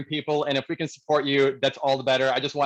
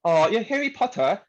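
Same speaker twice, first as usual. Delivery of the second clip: higher, tentative British accent; 8 semitones higher than usual. Shown as a demonstration. Interviewee tries a British accent on request, higher British accent. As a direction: attempt a British accent, halting before the last word. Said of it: "do a British accent".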